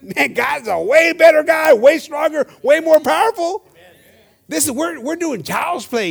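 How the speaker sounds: loud, high, building sermon shout; exclaimed preaching. Real, not acted. Preacher telling church God is way stronger, shouted preaching. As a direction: proclaim it to the back rows. Preaches God's greater power.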